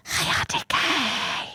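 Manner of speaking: put-on high, slow breathy child voice; mock-innocent little girl greeting. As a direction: put on a sweet little kid's voice. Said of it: "She's doing this voice".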